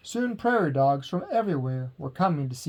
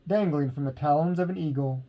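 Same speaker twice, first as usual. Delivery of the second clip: ordinary delivery, nothing marked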